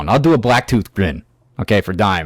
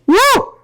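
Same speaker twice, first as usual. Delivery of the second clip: high whooping cry of excitement; excited woo. Real, not acted. Ranting vlogger whoops about devils in disguise, much higher. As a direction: let out a sudden whoop. Excited outburst mid-rant.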